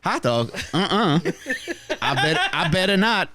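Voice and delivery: high stuttering robot voice; malfunctioning robot voice; glitching robot. Pretend. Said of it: "You just sound like a malfunctioning sex robot".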